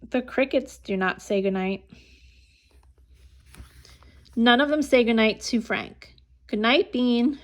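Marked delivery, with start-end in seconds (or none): none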